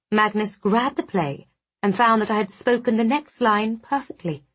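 ordinary delivery

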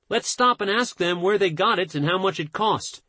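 ordinary talk

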